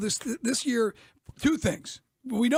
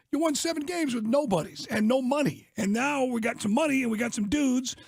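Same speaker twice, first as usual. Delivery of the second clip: ordinary talk, nothing marked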